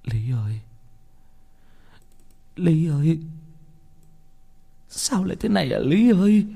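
grief from whisper to high wail; broken-hearted, long-paused calling to the dead; weeping lament. Acted. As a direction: sob her name, voice climbing. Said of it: "Khóc như là một đứa trẻ vậy".

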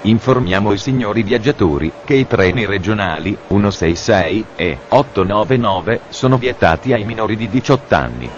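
ordinary talk

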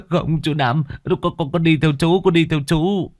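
cling to the uncle in fear; terrified begging. Acted. Young man begs uncle Năm to take him along, terrified.

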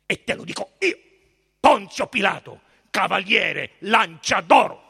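proud threat. A narrator's reading. Pilate threatens the high priest, loud and imperious. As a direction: proclaim the name and title forcefully.

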